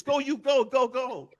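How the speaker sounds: high, urging go; wife urging him, high and eager. Retold or imitated. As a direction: push him out the door. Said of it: "My wife goes".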